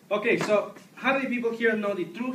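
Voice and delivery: put-on high Mexican-accented public speaking; fake Mexican accent. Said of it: "my accent is in fact fake".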